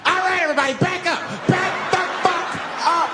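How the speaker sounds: high, frantic yelled warning; flipping-out shout. Acted. Cornered homeless man to the whole bus, flipped-out shouting. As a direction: yell it high, losing control. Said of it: "Now the guy flips out".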